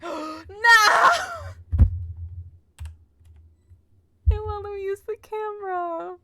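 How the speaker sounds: real anguished shout, high and loud; dismayed shout. Real, not acted. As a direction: gasp then a high, loud cry. Camera blocked, shouts in dismay.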